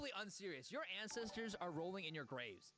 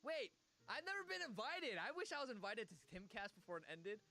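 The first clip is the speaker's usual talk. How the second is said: facetious; 2 semitones higher than usual. Pretend. Streamer jokes about never being invited on Timcast, facetious mock dismay. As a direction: protest with exaggerated, joking dismay. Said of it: "I'm being facetious".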